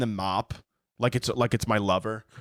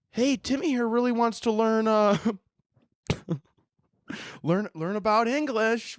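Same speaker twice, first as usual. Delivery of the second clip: high, hesitant, hammed-up encouragement; coaxing colleague; 8 semitones higher than usual. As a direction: gently sell the idea to someone fragile. Staff presenting a child, coaxing.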